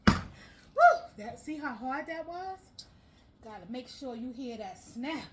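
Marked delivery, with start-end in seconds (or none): 0.1-1.0 loud, high victory cry; triumphant whoop. Real, not acted. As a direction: celebrate finally getting it. Piece finally snapped in.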